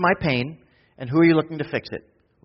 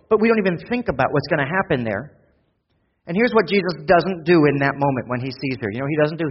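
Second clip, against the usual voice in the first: loud, high, driving sermon delivery; fiery pulpit climax, pushing the congregation; preaching at full force; 3 semitones higher, 4 dB louder than usual. Acted. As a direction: preach it hard and build the urgency. Preaching continues.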